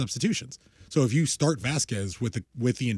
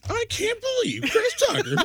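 high, whiny troll voice, laughing; squeaky outraged troll; internet troll voice; 3 dB louder, slower than usual. Pretend. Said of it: "your internet troll voice".

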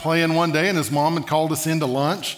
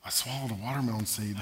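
very soft, lower voice; sheepish near-murmur; hushed confession; 7 semitones lower, 12 dB quieter, faster than usual. Acted. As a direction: confess it in a small, quiet voice. Child confessing mishap to grandfather.

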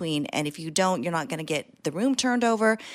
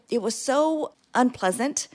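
ordinary delivery

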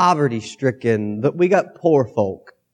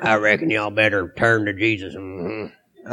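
Sling Blade voice; 5 semitones lower than usual. Acted. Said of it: "You sound like Sling Blade".

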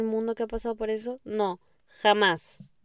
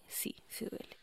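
small whispered confession to Daniela; whispered admission; 18 dB quieter than usual. Pretend. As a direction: whisper it, ashamed. Listener voiced answering Daniela.

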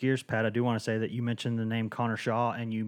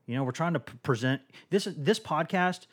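ordinary delivery

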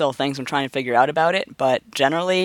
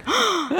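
high mock shocked gasp; mock offended gasp. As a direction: gasp in fake outrage. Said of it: "he's just like".

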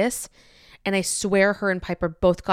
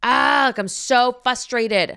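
loud, higher groan into slow venting; retold frustrated outburst, raised and stretched; venting yell; 4 semitones higher, 4 dB louder, slower than usual. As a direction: yell it out at nobody, exasperated. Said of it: "like, yelling".

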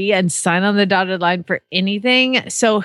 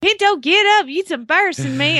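high mock Kentucky twang scolding; hammed-up Southern mom yelling at the horse; imitating a Kentucky mother scolding her horse Pinto; Kentucky accent; 8 semitones higher than usual. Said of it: "that's Kentucky".

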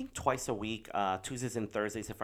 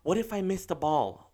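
higher, lightly voiced worried child; hesitant daughter; 7 semitones higher, 4 dB louder than usual. Daughter asking dad about missing ball, mildly worried. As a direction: imitate a kid tentatively raising a doubt. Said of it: "and she goes".

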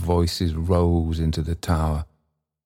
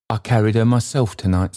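put-on higher, quick old man's voice; Trotty; 4 semitones higher, faster than usual. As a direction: protest quickly, pitched up.

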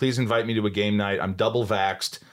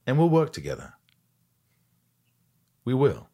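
imitating an intense actor, higher pitch, pauses; Gary Oldman intensity; 7 semitones higher, 3 dB louder than usual. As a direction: deliver a weighty promise with intensity. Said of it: "it was an intensity he had".

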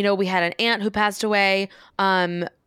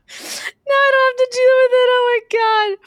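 high, loud squeal through real laughter; helpless squealing laugh, much higher and louder; laughing hard; 6 dB louder than usual. Real, not acted. Joking with sister about hiding the eye, squealing with laughter. Cracking up at own joke.

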